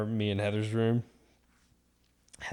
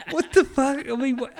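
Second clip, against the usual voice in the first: loud, high, cracking up in disbelief; a laughing yelp of disbelief; laughing outburst; 7 dB louder than usual. Real, not acted. Reliving the mess for a friend, loud laughing disbelief. Disbelief at his own story.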